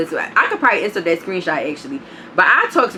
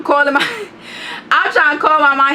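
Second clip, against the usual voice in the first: laughing; 7 semitones higher than usual. Real, not acted. Laughing while retelling chase.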